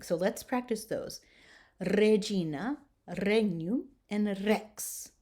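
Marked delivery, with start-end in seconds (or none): 1.8-5.1 "Regina" with a rolled R at the start; "regnum", "rex" with a trilled initial R. Shown as a demonstration. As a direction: roll the R at the beginning of each word. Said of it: "the R is rolled when it's at the beginning".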